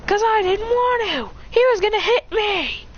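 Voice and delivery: high-pitched whiny protest; squealed gamer comeback; defensive yell. Acted. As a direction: shout back an excuse. Teammate shouts back defensively.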